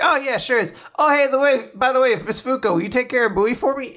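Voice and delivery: high, easy, offhand voice; breezy Shen. Retold or imitated. Shen asking Fuko a favour, high and breezy. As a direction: toss it off casually. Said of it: "Shen's like".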